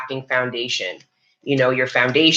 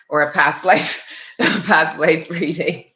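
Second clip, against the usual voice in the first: laughing; 3 semitones higher than usual. Real, not acted. Laughing at her own joke.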